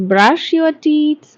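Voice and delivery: very slow and deliberate; slow model sentence. Shown as a demonstration. Teacher models the sentence slowly for students to repeat. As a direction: stretch every word for learners to copy. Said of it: "Repeat after me".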